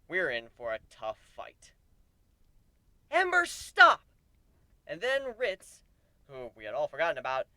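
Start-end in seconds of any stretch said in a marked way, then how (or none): 3.1-4.0 sharp high shout to stop her; shouted command. Acted. Companion yelling at the raging dragoness to stop. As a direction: yell her name to halt the attack.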